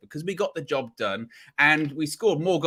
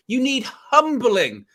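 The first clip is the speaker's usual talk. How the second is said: mocking rival fans; 5 semitones higher, 4 dB louder, slower than usual. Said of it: "That's what they said".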